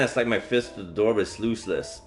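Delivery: usual speaking voice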